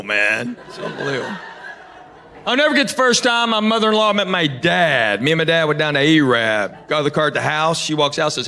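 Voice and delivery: usual speaking voice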